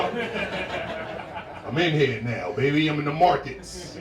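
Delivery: playful boastful hype; hyped. Pretend. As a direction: strut it like a big-time trader. Brags about being in markets.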